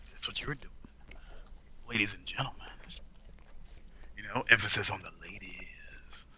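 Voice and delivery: low whispered seductive announcer; sexy whisper voice. Pretend. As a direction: breathe it low like a lover. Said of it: "I know I'm doing your thing".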